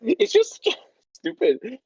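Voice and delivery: laughing. Real, not acted. Laughing at the bit.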